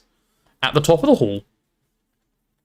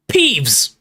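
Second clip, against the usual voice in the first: higher, acted angry call; angry yell; 5 semitones higher than usual. A narrator's reading. Professor calling a troublesome poltergeist, angry yell. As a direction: call out crossly. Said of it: "yelled an angry voice".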